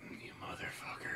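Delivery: hushed mock outrage; whispered you got me. Real, not acted. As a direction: breathe it out in disbelief. Friend's mock outrage at reveal.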